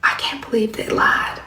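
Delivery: whispered secret, breath only; retelling a shopper's hushed confession at the checkout, whispering. Retold or imitated. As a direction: lean in and whisper it like gossip. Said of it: "she got real quiet".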